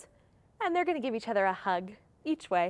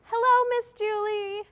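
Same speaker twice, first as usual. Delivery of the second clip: sing-song prompt; 9 semitones higher than usual. Shown as a demonstration. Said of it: "Can you say".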